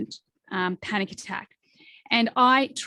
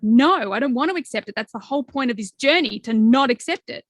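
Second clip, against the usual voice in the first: protesting ego; 3 semitones higher, 7 dB louder than usual. Acted. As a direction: protest like a stubborn inner voice. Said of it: "my ego or that part of me is like".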